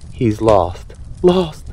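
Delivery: mild mock alarm from a parrot; panicked cry. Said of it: "cried the parrotfish".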